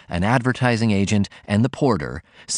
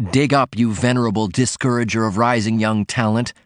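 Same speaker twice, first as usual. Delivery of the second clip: ordinary delivery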